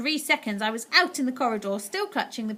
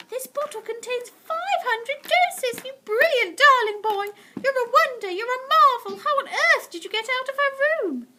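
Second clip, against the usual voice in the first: much higher, louder praise; delighted grandmother; 6 dB louder than usual. A narrator's reading. Grandmother praising her grandson, delighted. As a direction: gush with delight.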